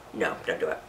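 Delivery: whispered no, don't do it; hushed warning to the viewer. Real, not acted. Warning against caked makeup, whispered.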